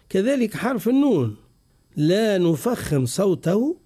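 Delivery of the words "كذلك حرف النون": usual speaking voice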